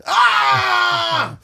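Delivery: very high, loud comic scream; scream of pain. Acted. Comedian acting out stepping on a stonefish, scream of pain. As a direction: scream as if stung, cartoon style.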